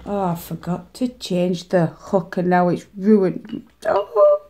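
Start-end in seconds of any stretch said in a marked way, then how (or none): 3.8-4.4 high, loud wail of dismay. Real, not acted. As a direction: cry out over the ruined work. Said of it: "I'm gonna cry".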